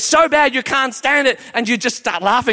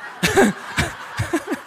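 high giggle; 8 semitones higher, 5 dB quieter than usual. Real, not acted. Preacher laughing.

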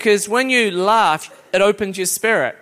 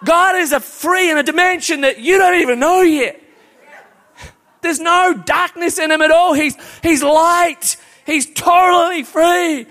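fervent preaching; 7 semitones higher, 3 dB louder than usual. Real, not acted. Preaching builds to a shout.

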